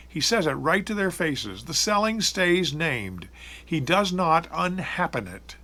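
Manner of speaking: ordinary delivery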